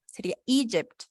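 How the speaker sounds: Egypt with the weight on the first syllable E; stress on first syllable. Shown as a demonstration. Teacher models Egypt for the student, first syllable stressed. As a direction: lean on the start of Egypt. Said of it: "el acento va en la primera".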